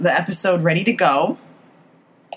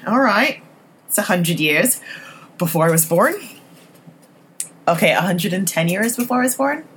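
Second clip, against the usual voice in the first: normal talking voice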